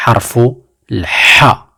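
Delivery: harfu l-ha said slowly, with a throaty h; teacher naming the letter harfu l-ha; Arabic letter name. Shown as a demonstration. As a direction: stretch out harfu l-ha. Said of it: "On l'appelle".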